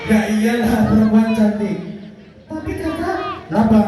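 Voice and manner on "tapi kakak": high, soft little puppet voice; monkey puppet voice. Acted. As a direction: squeak it like a shy monkey puppet. Puppet monkey talks to host.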